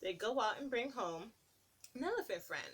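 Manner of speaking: put-on lilting read-aloud to little kids; sing-song picture book narration; storybook reading. Reading a picture book to children, lilting.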